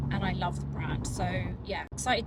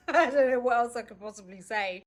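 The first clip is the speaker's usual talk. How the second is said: laughing; 3 semitones higher, 6 dB louder than usual. Real, not acted. Laughs at own stock greeting.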